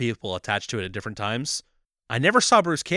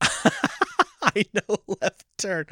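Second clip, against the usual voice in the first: very slow movie quote; 8 semitones higher, much slower than usual. Retold or imitated. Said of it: "That's amazing. That's perfect".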